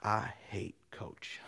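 low, slow, hushed chant to himself; slow half-whispered personal mantra; muttered mantra. Retold or imitated. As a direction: mutter it under your breath, word by word. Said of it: "he'd get a little mantra".

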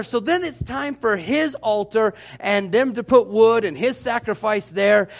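ordinary delivery

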